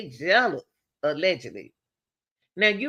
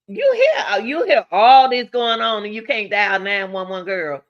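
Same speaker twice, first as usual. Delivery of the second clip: exasperated yelling; 2 semitones higher, 7 dB louder than usual. Real, not acted. Scolding the roommate who heard everything but didn't call, loud and exasperated. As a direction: shout it at her like she's right there.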